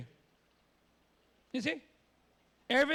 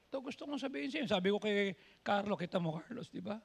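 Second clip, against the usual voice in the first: ordinary delivery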